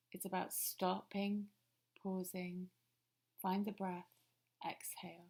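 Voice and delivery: soft, slow steps with pauses; calming guided summary. Shown as a demonstration. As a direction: let each step settle gently. Soothing step-by-step calming summary.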